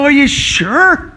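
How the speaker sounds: slow, drawn-out sceptical question; a hint of a doubting listener; lightly voiced sceptic; doubtful asking. Doubting congregant voiced in sermon.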